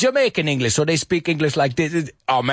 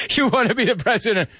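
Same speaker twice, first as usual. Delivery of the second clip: mocking laugh; 5 semitones higher than usual. Mocking people laughing at dream.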